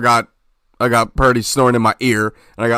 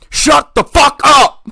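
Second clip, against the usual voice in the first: high, loud, slow imitating shout at a snorer; retold angry yell; 5 dB louder, slower than usual. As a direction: bellow it like a roommate woken up. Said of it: "This motherfucker's yelling at me".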